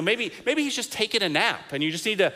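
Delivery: hammed-up taunt at the rival prophets; mocking taunt. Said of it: "Elijah actually kinda taunts them".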